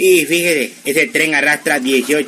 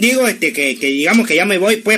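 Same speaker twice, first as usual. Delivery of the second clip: higher, stumbling excuse; flustered cover-up; 5 semitones higher than usual. Acted. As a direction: backtrack hastily, pitched up, for mother. Disimula ante la madre. (disimulando nervioso, con voz aguda)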